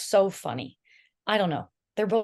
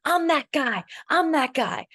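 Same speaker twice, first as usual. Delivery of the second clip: cocky boast; 9 semitones higher, 4 dB louder, slower than usual. Retold or imitated. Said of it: "he was like".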